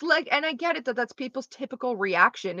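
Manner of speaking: ordinary delivery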